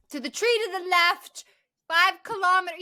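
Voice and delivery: mock the friend giving directions. Mocking the friend who gives directions, high voice. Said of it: "mocking the missing friend".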